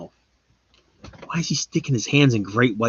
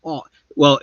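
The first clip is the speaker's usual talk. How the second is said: ordinary talk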